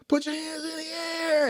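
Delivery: high, sustained mock cop yell; long-held high police call; retold police order. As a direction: imitate an officer's drawn-out command without real volume. Officer orders him at gunpoint.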